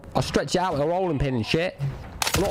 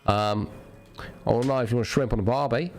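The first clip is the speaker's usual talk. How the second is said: lowered, put-on Aussie accent; mock Aussie accent; 6 semitones lower than usual. Shown as a demonstration. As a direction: lay on a deep Aussie accent. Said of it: "If I wanna sound like an Aussie".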